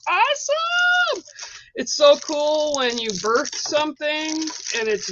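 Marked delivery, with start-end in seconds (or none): 0.1-1.2 much higher, drawn-out awesome; thrilled squeal. Real, not acted. Host unwraps the deck for viewers, slow high squeal. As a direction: stretch out a squeal of delight.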